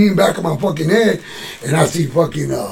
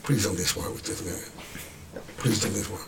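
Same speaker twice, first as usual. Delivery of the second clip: low, hushed pleading under the breath; inner plea; 9 semitones lower, 16 dB quieter than usual. Retold or imitated. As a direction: beg quietly as if only in your head. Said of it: "I'm saying in my mind like this".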